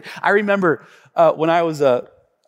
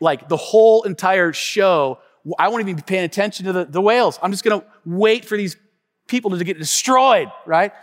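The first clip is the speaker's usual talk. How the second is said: normal talking voice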